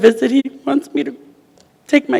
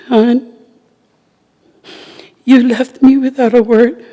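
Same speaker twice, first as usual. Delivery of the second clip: higher, strained voice near tears; tearful farewell letter; 5 semitones higher than usual. Real, not acted. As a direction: read the letter to him fighting tears. Reads farewell letter to husband.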